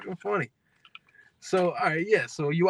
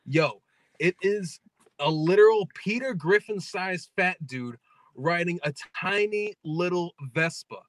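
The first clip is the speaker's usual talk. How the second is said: usual speaking voice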